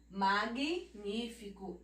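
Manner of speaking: magnífico slowed, divided after "mag"; "Magnífico" as mag(ui), pause, ní-fi-co; consonant only. Shown as a demonstration. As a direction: separate the g in Magnífico before "nífico". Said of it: "você fala só a consoante".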